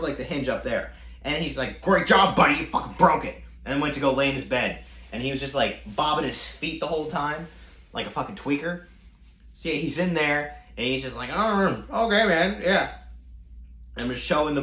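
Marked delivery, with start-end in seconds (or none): 1.8-3.4 raised, louder imitation of a sore roommate; mock angry sarcasm. 11.3-12.9 higher-pitched mock agreement; imitated roommate. Imitates sulking roommate on bed.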